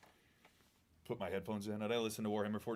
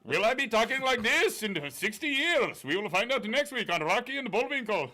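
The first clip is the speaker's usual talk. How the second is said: higher, louder mock Indian accent with laughs; terrible Indian accent; 11 semitones higher, 7 dB louder than usual. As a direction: ham up the accent, chuckling. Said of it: "That was a terrible Indian accent".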